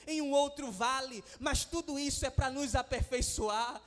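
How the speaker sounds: loud, impassioned preaching over music; preaching at full force. Acted. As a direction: build the fire of a revival sermon, stretching key words. Pastor preaching to congregation. (pregação inflamada e forte)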